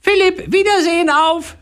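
very high loud parrot farewell; parrot calling. Acted. Parrot calling farewell to the boy from the UFO. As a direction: call goodbye like a parrot flying off. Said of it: "hörte er den Papagei noch rufen".

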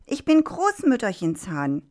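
high-pitched, indignant rooster; goading indignation. A narrator's reading. Rooster goads the fox into answering the farmer, indignant.

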